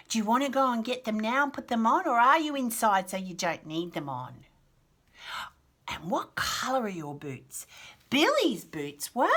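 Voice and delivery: usual speaking voice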